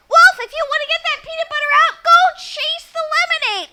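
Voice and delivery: put-on a fearless girl ordering the wolf away; bossy little girl. Girl with girl power commands the wolf.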